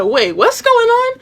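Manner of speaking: very high, drawn-out curious question; slow, high-pitched prying; nosy bystander. Retold or imitated. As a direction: imitate a nosy eavesdropper. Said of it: "she's being nosy".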